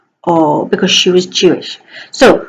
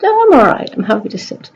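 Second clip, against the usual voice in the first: dog's voice; 2 semitones higher, faster than usual. Pretend. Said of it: "He said".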